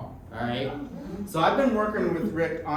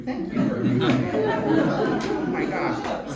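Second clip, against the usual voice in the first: laughing thanks; 5 dB louder, slower than usual. Real, not acted.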